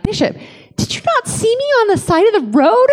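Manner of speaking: high-pitched indignant question; mock reproach. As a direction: scold him in playful disbelief.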